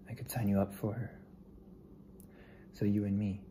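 ordinary talk

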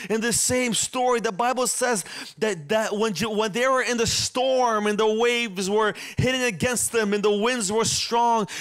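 normal talking voice

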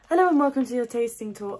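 loud bright announcer start; too loud for ASMR. Real, not acted. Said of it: "Oh, sorry guys, this is an A S M R video".

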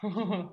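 short laugh. Real, not acted. Dog joins the workout.

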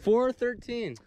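real loud, high shout; called-out weight. Real, not acted. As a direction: call out the result loudly. Calls out the fish's weight.

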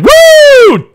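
piercing high whoop of thrill; exultant whoop. Acted. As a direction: let out a wild cheer. Pilot whoops mid-dogfight.

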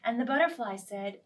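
usual speaking voice

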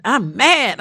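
a caller's sudden loud "I'm mad", mock complaint; mock angry caller. A host imitating a caller who complains about the shutdown, loud and mock angry. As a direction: burst out like an upset caller, half-acted.

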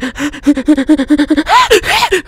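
loud, high giggling fit; excited giggle. Real, not acted. Gamer allowed inside the Ferrari, giggling wildly. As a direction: burst into high, rapid giggles. Thrilled she can drive it.

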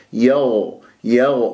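"يو" as "yagh", voice flowing without air; voiced flowing ghayn. Shown as a demonstration. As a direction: sustain a voiced gh with unrounded lips in "يو". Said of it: "الغين يجري فيها الصوت".

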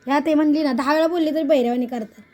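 loud, high, fast scolding; scolding husband. Real, not acted. Scolding husband over the food. (नवऱ्याला मटकी न ठेवल्याबद्दल रागाने ओरडून रागावणे)

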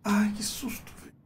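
breathless whispered relief; panicking gamer. Real, not acted. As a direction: whisper it, heart still racing. After jump scare.

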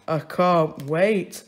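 slow, dry mock enthusiasm; sarcastic dad. Mr Large sarcastically replies about the sardine dinner. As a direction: drag it out sarcastically. Father dreading diet food.

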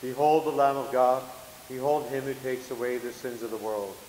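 ordinary delivery

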